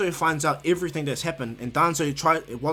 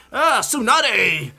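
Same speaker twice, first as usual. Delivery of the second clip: loud, high, slow hail; booming entrance; 8 semitones higher, 8 dB louder, much slower than usual. Retold or imitated. Jiraiya bursting through the door, loud slow hail. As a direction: call her name big and drawn out as you burst in.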